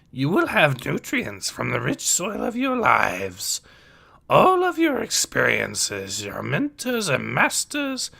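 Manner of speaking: put-on wise old teacher with swooping pitch; Yoda's voice. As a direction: question the pupils as the old sage.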